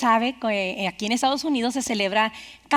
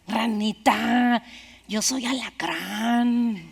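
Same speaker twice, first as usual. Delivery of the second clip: slow, softer, final verdict; cold scorpion; 3 semitones lower, 5 dB quieter, much slower than usual. A narrator's reading. Scorpion answering the dying frog, slow and cold. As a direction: let each word fall slowly, no remorse.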